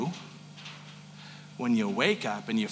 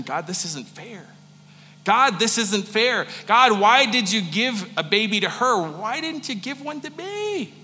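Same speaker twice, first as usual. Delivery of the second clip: anguished crying out; 7 semitones higher than usual. Pretend. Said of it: "she's crying out".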